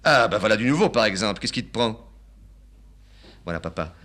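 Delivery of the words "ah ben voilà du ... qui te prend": loud, high, outraged father; father scolding his son for refusing the train, loud and high; indignant old father. Acted. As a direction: blow up at the son. Father taken aback.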